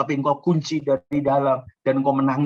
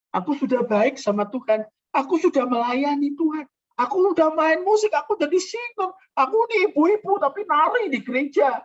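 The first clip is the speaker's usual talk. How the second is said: mock self-righteous boast; 10 semitones higher, 4 dB louder than usual. Parodying self-righteous worshipper.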